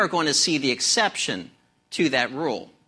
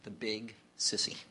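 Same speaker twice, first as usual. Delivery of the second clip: trembling voice; 7 semitones lower, 13 dB quieter than usual. A narrator's reading. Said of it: "a trembling voice said".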